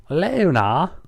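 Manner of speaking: earnest rising Luna; earnestly. Shown as a demonstration. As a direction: plead with her by name. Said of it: "Twilight said earnestly".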